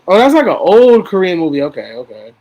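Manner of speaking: ordinary talk, nothing marked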